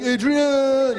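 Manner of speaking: drawn-out high movie-hero cry; Rocky. Acted. Comic imagining his glorious movie injury, drawn-out heroic cry. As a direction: call out like a battered champion. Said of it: "Like Rocky".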